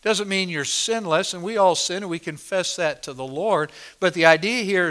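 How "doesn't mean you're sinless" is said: ordinary talk, nothing marked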